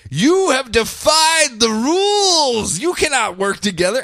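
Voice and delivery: slow, high, hammed-up godlike proclamation; thundering voice. A voice from the heavens to rule-breakers, thundering voice. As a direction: stretch each word out like a decree from above. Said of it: "a thundering voice comes from the heavens".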